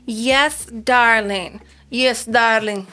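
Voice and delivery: hammed-up, badly faked Russian accent; terrible Russian accent. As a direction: put on a bad Russian accent. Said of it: "That was my terrible Russian accent".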